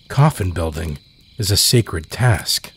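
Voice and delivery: ordinary delivery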